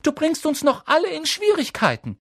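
very high, loud protest; alarmed schoolgirl warning; Hermine calling out. A narrator's reading. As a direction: scold them urgently at high pitch. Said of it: "rief Hermine Granger".

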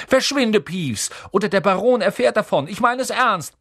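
high, loud angry bark; barked order. A narrator's reading. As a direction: bark a threat to drive him away. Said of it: "bellte Percy".

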